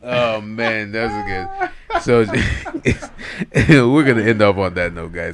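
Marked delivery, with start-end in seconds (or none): 2.3-3.9 loud, higher burst of laughter; laughing. Real, not acted. Co-host breaking up while ending the show, loud laugh. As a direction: crack up before signing off.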